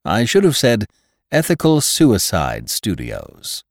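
bright, loud, bustling doctor; cheerful hurried official; brisk doctor voice. A narrator's reading. Hitz voiced by reader.

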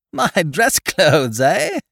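slow chuckling words, rising to a high eh; amused disbelief with a laugh through it; genial laugh. Acted. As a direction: laugh it off jovially. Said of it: "I laughed genially".

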